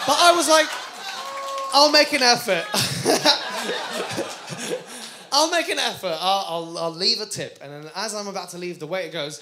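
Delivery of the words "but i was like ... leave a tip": loud resolve. Retold or imitated.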